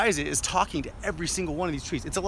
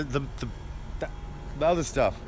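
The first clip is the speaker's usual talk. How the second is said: normal talking voice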